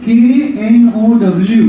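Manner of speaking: slow, deliberate spelling of "केएनओडब्ल्यू" for the class; teacher spelling "केएनओडब्ल्यू" one letter at a time. Shown as a demonstration. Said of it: "English मध्ये एक word आहे".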